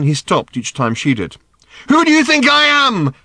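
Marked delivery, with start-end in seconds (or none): 1.9-3.1 very high, loud shout; angry demand. A narrator's reading. Daughter turns to shout at her father, demanding angrily. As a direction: demand it at the top of your pitch. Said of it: "she demanded angrily".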